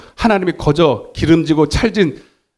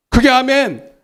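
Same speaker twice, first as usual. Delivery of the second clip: high, emphatic call for an amen; rousing amen prompt; loud amen; 5 semitones higher, slower than usual. Shown as a demonstration. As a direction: call out the amen higher, urging the congregation to say it big. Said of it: "그게 아멘".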